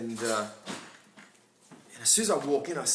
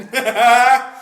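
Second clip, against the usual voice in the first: loud burst of laughter; 6 semitones higher, 13 dB louder than usual. Real, not acted.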